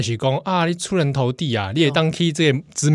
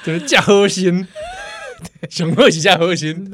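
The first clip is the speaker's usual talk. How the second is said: stifled giggles; 5 semitones higher than usual. Real, not acted. Laughing reply.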